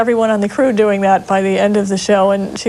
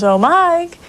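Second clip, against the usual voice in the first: lightly higher, sweet wife's call; Carol's voice; 8 semitones higher than usual. Retold or imitated. Co-star imitating Carol calling her husband, lightly higher. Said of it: "and she goes".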